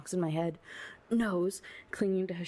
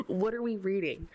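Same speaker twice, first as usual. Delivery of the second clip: usual speaking voice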